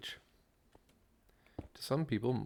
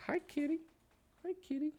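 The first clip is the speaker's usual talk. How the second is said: very high falsetto cooing to a cat; pet-talk voice. Real, not acted. Gamer greeting his distracting kitty, high-pitched pet talk. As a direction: squeak a little hello to the kitty.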